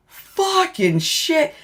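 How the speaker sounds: high, slow, drawn-out curse; imitated stunned cursing. Partner seeing the searched inmate, stunned slow curse. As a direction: stretch out the appalled reaction. Partner reacts to what he sees.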